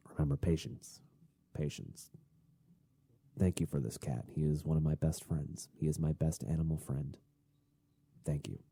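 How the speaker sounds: low, soft murmured self-reminder; calming oneself. Real, not acted. Podcaster giving thanks for the cat to regain patience, hushed and calm. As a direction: quietly talk yourself down, almost like a prayer.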